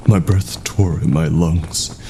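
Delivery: ordinary delivery, nothing marked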